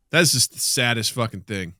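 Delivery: ordinary talk, nothing marked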